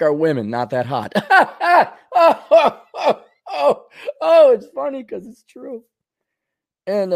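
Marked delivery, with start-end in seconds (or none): 1.2-1.9 high, loud cackle; hammed-up laugh. Real, not acted. Comic after his own weather joke, high loud laugh. 2.1-5.8 high, laughing sighs into words. Real, not acted. Comic enjoying his own weather joke, high and laughing.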